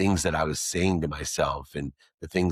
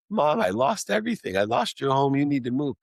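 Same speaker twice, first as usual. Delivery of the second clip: distraught confession; 8 semitones higher than usual. Retold or imitated. Son confessing to his mother he lost her home, distraught and higher. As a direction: blurt it out falling apart.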